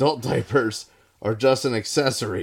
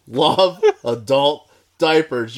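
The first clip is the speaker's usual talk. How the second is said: slow drawn out; 4 semitones higher, 5 dB louder, slower than usual. Pretend. Repeating the line hammed.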